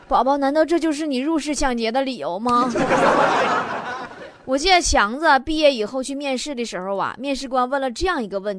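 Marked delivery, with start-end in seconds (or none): 2.8-4.4 short unvoiced chuckle; airy laugh. Real, not acted. Host chuckling after the punchline, airy laugh. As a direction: breathe out a laugh.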